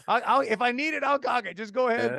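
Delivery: high-pitched retold objection; mildly acted-out urgent insistence; exasperated protest. Protesting being handed cocked gun.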